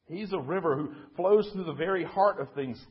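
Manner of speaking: forceful narrated proclamation; thundering waterfall. A narrator's reading. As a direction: send it out like rushing water. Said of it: "thundered the waterfall".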